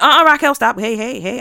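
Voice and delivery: loud, sharp burst of objection; acted interruption. Friends catching her negative words, loud objection. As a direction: cut in loudly to stop a friend.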